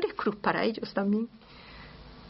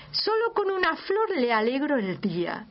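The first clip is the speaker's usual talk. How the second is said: lightly voiced wife; 6 semitones higher than usual.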